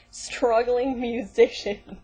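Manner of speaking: mock the phrase with heavy sarcasm; scornfully stretched out; mocking repeat.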